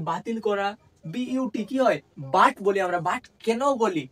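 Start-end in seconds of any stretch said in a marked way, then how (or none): 2.4-2.5 বাট with the u said as an open "aa". Shown as a demonstration. Teacher shows students the vowel in but, pronunciation demo. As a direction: say বাট as "baat". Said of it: "ইউ এর উচ্চারণ আ এর মতো".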